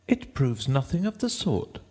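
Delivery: put-on high-pitched defiant girl; Alice. A narrator's reading. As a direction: refuse in a high girl's voice. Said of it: "said Alice".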